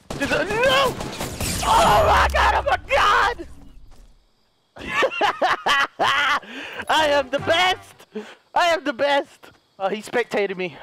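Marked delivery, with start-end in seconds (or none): 0.1-3.5 high, frantic shouting; panicked screaming. Real, not acted. Gamer caught in a firefight, panicked screaming. 4.8-9.5 laugh, then crow it high; high, drawn-out gloating; triumphant boast. Real, not acted. Gamer bragging after a win, triumphant.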